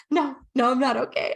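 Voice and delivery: mild imitated tearful protest; imitating tearful client. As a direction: replay the client's upset "no, no" with a hint of crying. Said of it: "I hear her sniffling and she's like".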